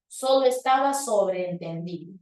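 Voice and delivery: ordinary talk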